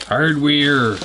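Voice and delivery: hardware in a broad Chicago accent. Shown as a demonstration. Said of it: "as my Chicago accent would say".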